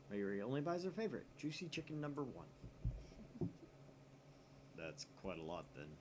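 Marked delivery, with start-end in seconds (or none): 2.5-4.7 high laugh. Acted. Okabe laughs at Mayuri's chicken.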